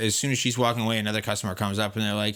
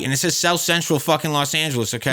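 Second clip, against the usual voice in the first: loud, higher-pitched protest; heated correction; 6 semitones higher, 7 dB louder than usual. Real, not acted. Host correcting co-host about the hat, heated. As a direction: cut in loudly to set them straight.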